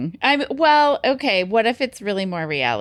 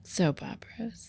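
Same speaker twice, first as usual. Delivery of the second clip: whispering; 3 semitones higher, 7 dB quieter than usual. Pretend. Whispered reveal.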